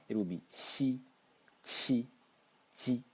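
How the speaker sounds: demonstrating Mandarin xi qi ji; Chinese xi qi ji. Shown as a demonstration. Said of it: "if I start pronouncing the first row".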